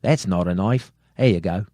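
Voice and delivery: put-on Aussie catchphrase; Australian accent. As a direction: attempt a broad Australian accent. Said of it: "that was a little bit better".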